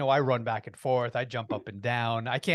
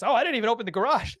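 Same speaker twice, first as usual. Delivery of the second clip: high, loud, rushed exclamation; panicked realization; 10 semitones higher, 7 dB louder, faster than usual. Retold or imitated. Driver remembers the closed garage, sudden alarm. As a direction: blurt it out as a retold panic.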